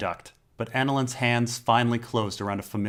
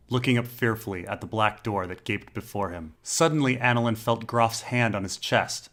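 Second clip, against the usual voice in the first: plain reading voice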